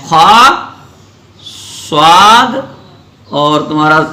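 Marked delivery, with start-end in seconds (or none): 0.1-0.5 خا loud, with a full, rounded "khaw"; heavy letter. Shown as a demonstration. Teacher listing heavy letters, full-mouthed held "khaa". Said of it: "جو موٹے پڑھے جاتے ہیں". 1.9-2.6 صاد with a thick, rounded "swaad"; heavy letter. Shown as a demonstration. Teacher listing heavy letters, thick held "swaad". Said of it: "جو موٹے پڑھے جاتے ہیں".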